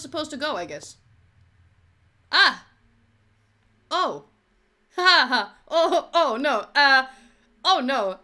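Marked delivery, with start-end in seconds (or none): 2.3-8.2 loud, laughing panic; startled yelling. Real, not acted. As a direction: yelp and laugh in alarm. Startled by monster chase.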